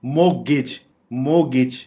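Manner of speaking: "मोगेज" as MO-gich; silent t. Shown as a demonstration. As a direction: model "मोगेज" with a short final vowel, no t. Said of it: "टी silent रहेगा".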